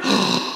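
sharp, astonished intake of breath; awed gasp at a magic trick; gasp of wonder. Shown as a demonstration. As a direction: demonstrate wonder with one gasp. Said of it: "You know that wonder?".

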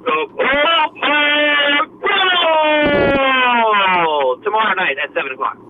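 long stretched high ring announcer shout; wrestler MC voice. Shown as a demonstration. Promoter showing the host his wrestling announcer voice. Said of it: "you gotta use your wrestler M C voice".

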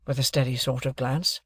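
usual speaking voice

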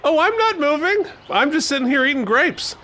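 lazy pampered brat; smug lounging voice. Pretend.

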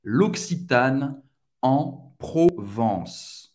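slow "L'Occitane en Provence", syllables separated, nasal "en"; careful French pronunciation. Shown as a demonstration. Teacher modelling the whole brand name, careful French pronunciation. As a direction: spell out "Provence" as pro-VANSS, slowly. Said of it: "pode pronunciar a marca inteira".

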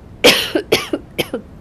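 a sudden dry cough; real cough. Real, not acted. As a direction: cough that interrupts the reading. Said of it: "Excuse me".

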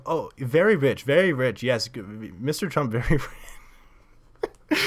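emphatic mock Polish accent; Polish-accented gushing, cracking up at the end; excited Polish accent. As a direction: imitate an impressed tourist with a Polish accent. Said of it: "I'm pretty sure it was Polish accent".

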